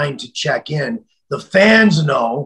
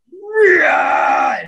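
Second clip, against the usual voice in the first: angry yell. Retold or imitated. Wrestler snapping back at a threatening caller, furious.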